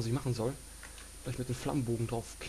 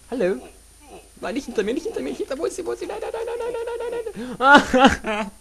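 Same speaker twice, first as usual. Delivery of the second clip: panicked high screaming; 13 semitones higher, 11 dB louder than usual. Real, not acted. Enemy behind him, panic.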